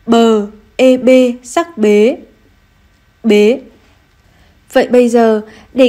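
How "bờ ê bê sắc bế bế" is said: slow spelling then reading. Shown as a demonstration. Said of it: "cùng cô đánh vần và đọc trơn".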